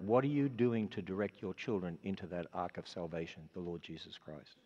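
normal talking voice

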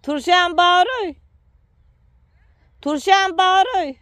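loud, high and slow call; drawn-out high shout across the yard. Real, not acted. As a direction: holler slowly so it carries far. Calling to someone far off.

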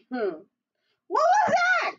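high, loud startled outburst; startled cry. A narrator's reading. Giant hearing Jack in the kitchen, startled cry. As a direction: cry out in alarm. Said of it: "cried the giant".

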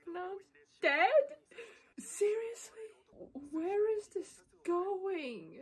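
high, loud cry of disbelief; can't believe she's dead; stunned disbelief. Real, not acted. Viewer reacting to a character's death, stunned and loud.

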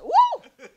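high-pitched comic "woo"; sudden high mock-startled cry; startled whoop. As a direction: act out getting caught with a yelp. Startled at hearing God's voice.

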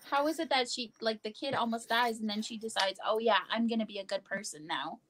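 usual speaking voice

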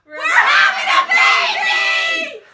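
loud excited announcement; joyful shout. Real, not acted. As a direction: shout the news with joy. Shouting the pregnancy news.

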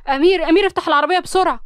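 alarmed shout to open the car; startled shout. A narrator's reading. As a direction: yell for help in sudden alarm. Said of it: "عمر بخض قال".

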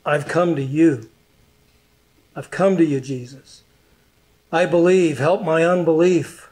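heartfelt prayer. Acted. Preacher voices a prayer.

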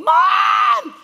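high, loud call for help; screaming for mom. Retold or imitated. Sore teen calls his mom from bed, loud and high. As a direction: yell for your mother from bed.

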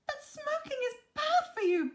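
high, loud scolding Betty; high-pitched telling-off; exclaiming. A narrator's reading. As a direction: exclaim a shocked warning in a high voice. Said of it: "Betty exclaimed".

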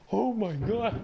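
loud high shriek of fright; jolted shriek at a jump scare; startled scream. Real, not acted. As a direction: scream in sudden terror.

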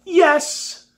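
very high, loud squeal of agreement; overjoyed. A narrator's reading. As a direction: burst out with uncontainable joy. Overjoyed pupil agrees at once.

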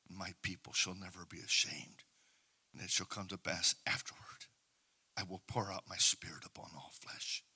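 low, hushed prophetic reading, fading to a whisper; soft scripture recitation. Acted. Preacher whispering God's promises over the people, hushed. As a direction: murmur God's promises softly.

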